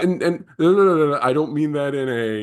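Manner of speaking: high, quick flurry of correction; hasty backpedal. Real, not acted. Hastily backpedals on own remark.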